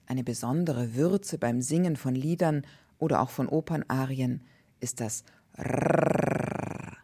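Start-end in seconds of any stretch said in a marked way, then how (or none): 4.8-7.0 Rrrr as a long rolled trill. Shown as a demonstration. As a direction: roll the Rrrr slowly. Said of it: "das rollende R".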